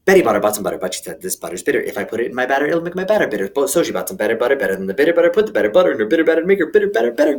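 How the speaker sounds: tongue twister rattled off as fast as possible; ultra fast. Shown as a demonstration. Ultra fast tongue twister run for the kids. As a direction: race through at top speed. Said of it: "Super duper ultra, ultra fast".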